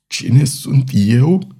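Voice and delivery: very slow, strangled question; choked voice. A narrator's reading. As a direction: force the words past a tight throat. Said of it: "rosti el cu un glas sugrumat".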